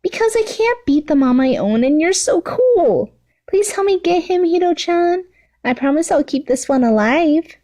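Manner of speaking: put-on high, whiny girlish pleading; begged. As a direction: wheedle like a spoiled kid. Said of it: "Toga begged".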